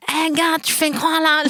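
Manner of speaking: mock indignant question. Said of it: "lui dire".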